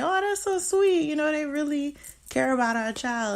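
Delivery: imitating our own sweet surprise, sing-song high; gushing delight. Wife recalls gushing over the caring couple, high excited.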